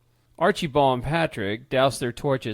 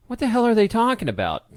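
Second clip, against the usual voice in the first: bewildered question; 9 semitones higher, 4 dB louder than usual. Acted. Confused man asks bewildered.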